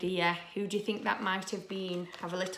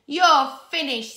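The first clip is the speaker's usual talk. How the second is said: loud, slow, higher storybook threat; villainous shriek; 7 semitones higher, 13 dB louder than usual. Acted. Evil pea to the fallen Super Potato, villainous shriek. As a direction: cry it out in mock triumph. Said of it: "he shrieked".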